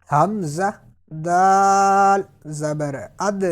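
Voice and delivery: اد ending with a bounced, echoing دال; qalqalah echo. Shown as a demonstration. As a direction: let the دال in اد bounce at the end. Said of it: "قلقلہ کی آواز نکالنی ہے".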